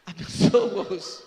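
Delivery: loud, slow, exaggerated holy tone; hypocritical mock blessing. Preacher mocking a hypocrite's blessing, falsely pious. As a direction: ham up the fake piety.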